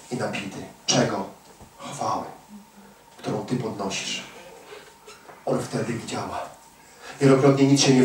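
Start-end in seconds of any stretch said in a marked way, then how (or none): 0.1-6.4 much softer, lower and intimate; hushed. Real, not acted. Preacher quietly telling the congregation when God acts, soft and low. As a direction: lean in and speak barely above a hush. Preacher drops to near whisper.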